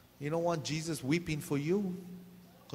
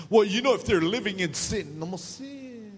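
higher, louder mock of a preachy critic; a hint of a know-it-all; lightly mocking voice; 6 semitones higher, 4 dB louder than usual. Pastor mocking people who judge others. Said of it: "Some people say".

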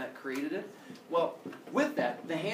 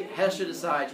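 ordinary delivery

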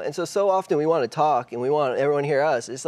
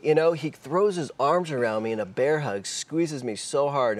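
ordinary delivery

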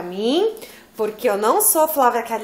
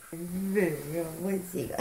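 tender; 5 semitones lower, 9 dB quieter, slower than usual. Real, not acted. Calling sleepy daughter to her.

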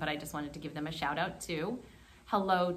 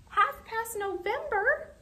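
surprised. Acted. Surprised reading of clock.

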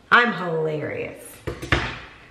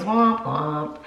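a lightly voiced womp womp, pitched down; lower sad trombone womp; 4 semitones lower than usual. Husband answers the sad book line with a low womp womp. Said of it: "And he goes".

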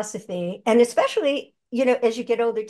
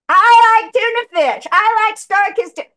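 much higher and louder put-on cartoon voice; different voice for the ad; 12 semitones higher, 10 dB louder than usual. Shown as a demonstration. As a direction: pitch it way up and punch it out like a commercial character. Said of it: "Read it in a different voice".

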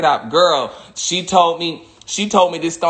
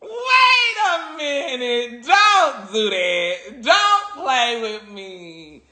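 slow high wail sliding down; mock-astonished wailing; 6 semitones higher, much slower than usual. Speaker reacting to the money stone, mock-astonished wailing. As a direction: stretch each word out in hammed-up disbelief.

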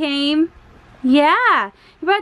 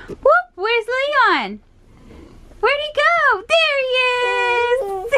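sing-song where is he; playful peekaboo; 9 semitones higher, slower than usual. Real, not acted. Peekaboo with baby.

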